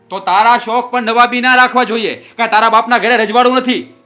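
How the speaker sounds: loud, high sneering shout; angry taunt. Acted. Husband mocking wife's expensive tastes, angry shout. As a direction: hit back at her fancy wishes.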